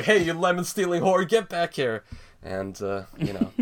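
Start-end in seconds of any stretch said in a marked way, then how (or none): 0.0-2.0 high, loud angry yell. Retold or imitated. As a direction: shout after the thief. Man yells at lemon thief.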